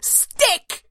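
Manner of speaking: high, thrilled yelp of a word; excited cry. Acted. Dog narrator spots a stick, bursts out excited.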